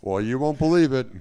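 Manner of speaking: loud, high late-night host punchline; Seth Meyers. Acted. Host teasing the audience, loud high impression voice. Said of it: "That's my Seth Meyers impression".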